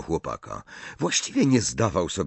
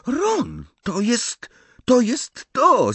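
very high, louder cry of delight; excited exclamation; 4 dB louder, much slower than usual. A narrator's reading. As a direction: cry out as the idea hits you. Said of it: "zawołała Hermiona". (podekscytowany okrzyk, bardzo wysokim głosem)

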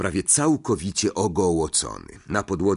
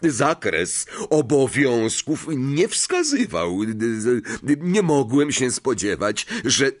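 slow, higher, halting justification; flustered excuse; 6 semitones higher, 3 dB louder, slower than usual. A narrator's reading. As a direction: stumble through excuses until cut off. Lockhart fumbles his excuse, interrupted.

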